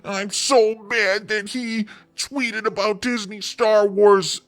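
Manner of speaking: high, whiny mock outrage; mock angry fan. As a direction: parody a fuming fan. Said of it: "and be like".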